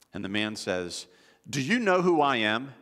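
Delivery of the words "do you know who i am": loud, higher, self-important outburst; indignant demand. Retold or imitated. Man at the airline counter to the agent, loudly indignant. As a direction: imitate an affronted, entitled passenger.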